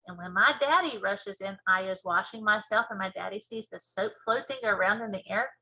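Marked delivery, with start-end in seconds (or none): none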